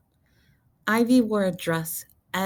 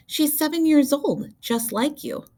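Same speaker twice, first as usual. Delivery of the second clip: high, coaxing mother's voice; a mother nudging her daughter toward the new girl; 11 semitones higher, 3 dB louder than usual. A narrator's reading. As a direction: sweetly urge your child, pitched up. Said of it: "said her mother".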